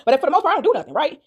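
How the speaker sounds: plain reading voice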